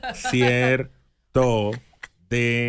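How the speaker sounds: drawn-out syllables with gaps; exaggeratedly slow. Shown as a demonstration. Host explaining step by step, exaggeratedly slow. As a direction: break it up painfully slowly. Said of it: "háblame al paso".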